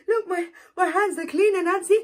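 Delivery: high, loud pleading; eager. Acted. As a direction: show off clean hands eagerly. Turtle proud clean hands.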